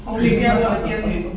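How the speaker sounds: usual speaking voice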